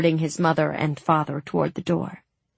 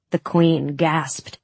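usual speaking voice

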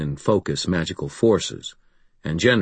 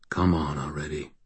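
soft, lower private plea; breathed to himself; 3 semitones lower, 5 dB quieter than usual. A narrator's reading. Wizard impatient for the summoning to work, muttering to himself. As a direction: say it under the breath, softly. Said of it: "I breathed to myself".

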